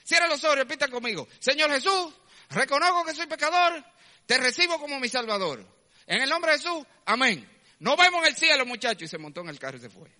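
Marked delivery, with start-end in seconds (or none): 0.0-9.0 fast, high, loud rattled-off prayer; mocking the jeep man's quick sinner's prayer, high and loud; mocking rushed prayer. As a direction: race through it like a magic formula. Jeep man leads quick prayer.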